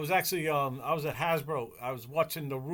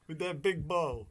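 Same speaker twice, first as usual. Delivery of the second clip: usual speaking voice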